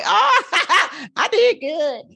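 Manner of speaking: high-pitched, laughing pleased reaction; pleased self-congratulation. Retold or imitated. Guest recalls realizing she bought before prices rose, pleased and laughing.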